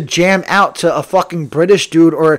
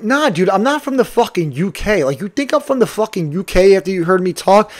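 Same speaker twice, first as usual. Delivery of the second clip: higher-pitched incredulous protest; imagined indignant reply; 3 semitones higher than usual. Retold or imitated. Driver's unsaid comeback to the passenger, imagined indignant protest. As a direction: object in disbelief, voice lifted. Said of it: "I couldn't be like".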